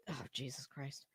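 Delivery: breathless whispered groan of disbelief; under the breath, fed up; whispered exasperation. Real, not acted. Muttering to herself while reading Betty's letter, whispered.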